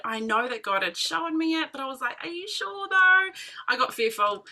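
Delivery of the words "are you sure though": high, slow, disbelieving; doubtful question. Retold or imitated. Questioning God about the plan, higher and disbelieving. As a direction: ask it like you can't quite believe it.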